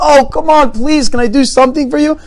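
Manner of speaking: high, loud, gushing offer; eager insistence. Acted. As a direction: plead excitedly to return the favor. Alien eagerly insists on repaying.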